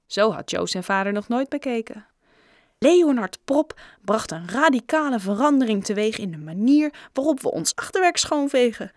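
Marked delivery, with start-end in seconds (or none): 2.8-8.9 amused, emphatic proclamation; chuckling. A narrator's reading. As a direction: declare it grandly, tickled by the joke. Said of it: "gniffelde Raj". (geamuseerd en nadrukkelijk)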